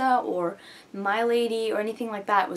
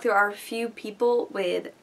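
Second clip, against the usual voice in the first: ordinary talk, nothing marked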